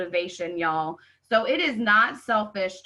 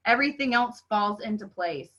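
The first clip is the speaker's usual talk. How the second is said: normal talking voice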